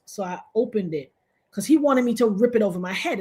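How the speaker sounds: ordinary talk, nothing marked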